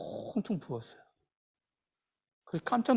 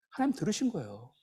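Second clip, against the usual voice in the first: normal talking voice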